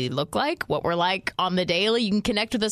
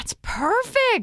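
mock excitement; 14 semitones higher, slower than usual. Real, not acted.

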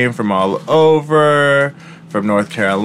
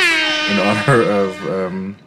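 chewing while talking; mouth full; 6 semitones lower, 3 dB quieter than usual. Real, not acted. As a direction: talk through a mouthful. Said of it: "I'm eating".

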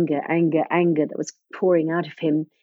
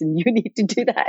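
laughing; 4 semitones higher, faster than usual. Real, not acted. Retold instructions, laughing.

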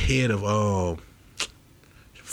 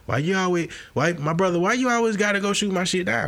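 offended protest; 9 semitones higher than usual. Acted.

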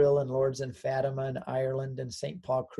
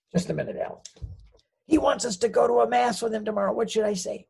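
excited, high-pitched; 9 semitones higher, 4 dB louder than usual. Retold or imitated. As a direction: voice jumps up high and eager. Asks wife, phone covered.

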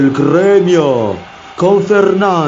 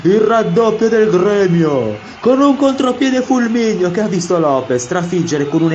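breathless italian goal commentary; 6 semitones higher than usual. Acted.

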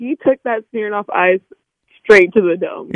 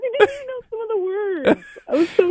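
mock crying; 13 semitones higher, 6 dB quieter, faster than usual. Mock disappointment over karaoke.

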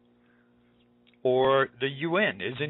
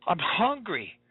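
put-on I'm hungry whined higher, a little child's voice; the child's hungry plea, whined higher; whining child; 5 semitones higher, much slower than usual. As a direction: whimper it like a teary kid. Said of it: "tears welling up ... saying".

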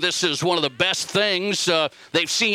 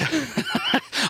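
a sudden high burst of laughter; real laugh; 11 semitones higher than usual. Real, not acted. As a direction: laugh it out, caught off guard. Host laughs at crying mom.

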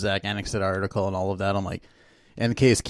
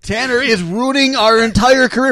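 shouting, higher and louder, building; table-pounding rant; 12 semitones higher, 9 dB louder than usual. Retold or imitated. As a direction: rant like an obsessed superfan. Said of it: "you're gonna be sitting here pounding the table".

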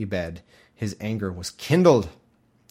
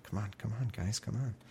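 softer hushed urging; mock signaling; 12 dB quieter than usual. Traitor quietly beckoning the army to the gate, hushed. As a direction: wave them in under your breath. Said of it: "he's, like, signaling".

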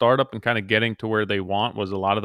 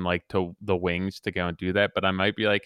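ordinary talk, nothing marked

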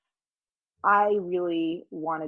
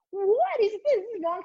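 put-on high, outraged child voice; indignant little girl. Little girl punched by a boy, high indignant child voice. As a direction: play yourself as a shocked little girl.

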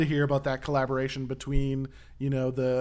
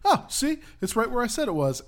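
much higher, light chuckling Lewis voice; smug chuckling quote; 9 semitones higher than usual. Retold or imitated. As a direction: toss it off like nothing happened. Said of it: "I loved him being like".

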